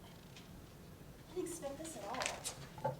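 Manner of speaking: stunned whisper. Acted. Kat stunned by settlement letter.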